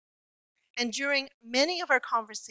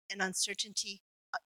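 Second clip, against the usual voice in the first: ordinary delivery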